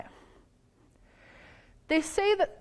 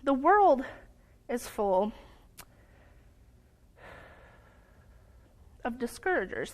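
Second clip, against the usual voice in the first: broken up by long pauses, groping for the words; a false start before the clean reading; halting fumbled take. Real, not acted. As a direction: stumble through it, stopping between chunks. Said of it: "There we go".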